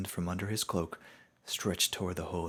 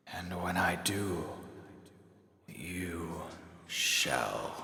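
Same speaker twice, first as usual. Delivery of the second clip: fading spirit; much slower than usual. Acted. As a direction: let the voice fade as he vanishes. Toll fades away.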